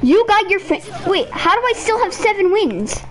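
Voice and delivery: outraged. Real, not acted. Gamer outraged at losing.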